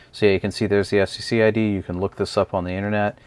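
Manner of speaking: ordinary talk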